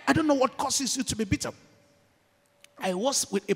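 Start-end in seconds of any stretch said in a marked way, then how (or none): 0.1-1.5 higher and louder, pleading; impassioned appeal. Real, not acted. Preacher appealing to bitter listeners, raised impassioned voice. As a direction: reach out to them with urgency.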